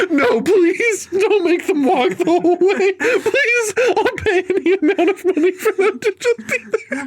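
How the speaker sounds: very high, laughing mock begging; laughing plea. Podcaster pleading with the movie to finish, laughing high. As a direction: plead for mercy while cracking up.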